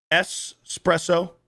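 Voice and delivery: espresso with a clean s, no k; slow, careful espresso, no k sound; Espresso said slowly and right; correct espresso. Shown as a demonstration. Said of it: "It is pronounced espresso".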